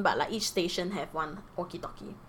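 normal talking voice

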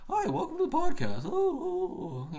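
very high, silly squeaky voice; mock him as a squeaky little podcaster; mocking real-life voice. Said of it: "in real life, he talks like this".

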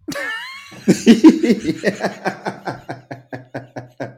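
high, loud laughing fit; burst of laughter. Real, not acted. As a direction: break out laughing hard. Laughing at the post.

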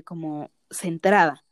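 ordinary delivery

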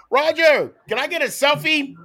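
very high mock fan squeal; fan calling out. Acting out asking the commissioner for a selfie, high fan call. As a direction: imitate an over-eager fan begging a favor.

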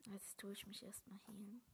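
whispering. Real, not acted. Relieved whispering after rescue.

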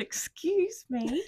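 high, slow sheepish excuse me; high slow embarrassed voice; timid excuse me. Retold or imitated. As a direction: squeak it out embarrassed. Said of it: "like that, like this".

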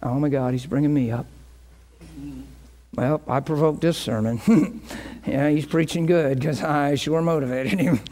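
low, softened voice of private self-importance; imagined congregant's thought. Acted. Congregant sitting there listening to their pastor, low private musing. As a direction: think aloud as a congregant, low and amused. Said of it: "and going".

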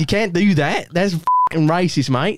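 put-on Aussie telling-off; mock Australian indignation; Australian accent. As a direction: scold a mate in an Australian accent.

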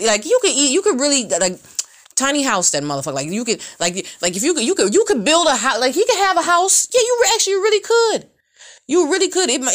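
ordinary talk

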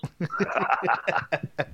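laughter breaking out after holding it in; finally lets the laugh out; burst of laughter. Real, not acted. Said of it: "trying hard not to laugh".

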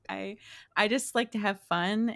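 ordinary talk

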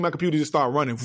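normal talking voice

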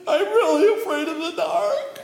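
high, loud crying wail; frightened wail. Acted. Tommy alone on the rock, loud frightened wail. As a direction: break into a scared wail.

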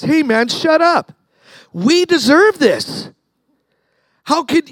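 high, drawn-out protest; indignant rebuke. Retold or imitated. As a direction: tell off a fellow prisoner, acting it out. Acted rebuke of the mocking prisoner.

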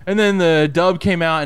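usual speaking voice

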